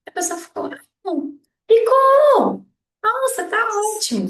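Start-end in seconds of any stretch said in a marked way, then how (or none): 1.0-4.3 high, drawn-out fake enthusiasm; insincere praise. Friend lying about how an outfit looks, insincere praise. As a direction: gush unconvincingly, much higher and louder. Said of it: "Você tá vendo que não tá".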